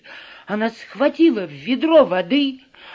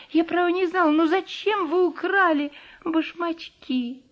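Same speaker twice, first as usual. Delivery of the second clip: timid apology in a higher voice; little girl voice; 7 semitones higher than usual. Acted. Ellie apologises to witch. (робкий голосок девочки)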